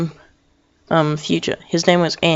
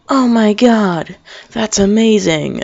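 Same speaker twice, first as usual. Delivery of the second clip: higher, slow hammed-up astonishment; drawn-out, pitched-up awe; mock amazement; 5 semitones higher than usual. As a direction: gush like a wowed onlooker. Acting out astonished people.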